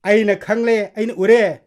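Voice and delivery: loud, insistent outburst; stern brother's acted outburst; forceful confrontation. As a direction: cut her off loudly. Elder brother confronts sister.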